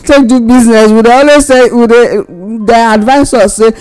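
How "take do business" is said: words broken by laughter, a bit louder; laughing while talking. Real, not acted. Laughing while mocking borrowing.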